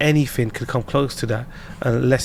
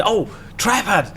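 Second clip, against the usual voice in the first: slow, higher mock repeat of her slip; mocking echo; 7 semitones higher, slower than usual. Husband catches his missus's word mistake, teasing. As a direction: pounce on the slip with laughing relish. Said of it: "if someone makes a mistake".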